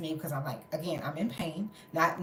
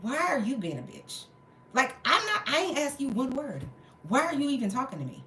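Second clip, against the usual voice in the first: higher-pitched retold indignation; angry; 6 semitones higher than usual. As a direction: demand to know why they're butting in. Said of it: "I just was so angry".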